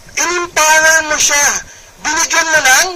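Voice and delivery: ordinary talk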